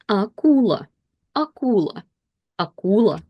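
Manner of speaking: Akula said with stress on U and a full clear A; careful Russian reading. Shown as a demonstration. Teacher reading akula aloud for learners, clear and careful. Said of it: "we read it exactly like we practiced".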